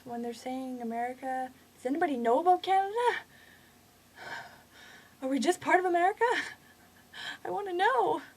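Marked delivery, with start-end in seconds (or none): 1.8-8.2 loud, high-pitched questioning; exasperated plea. Real, not acted. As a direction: call out to viewers, desperate for an answer. Rant climax, pleading question to viewers.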